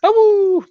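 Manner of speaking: high howling awoo; wolf howl. Pretend. Howls again after joke.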